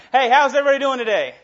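shouted, laughing greeting; loud greeting. Real, not acted. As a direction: call out loud to the whole room. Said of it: "Ooh, that was mediocre".

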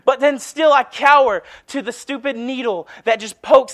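loud, high fervent preaching; preaching at full force. Acted. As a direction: shout it like a revival preacher. Preaching climax, shouting.

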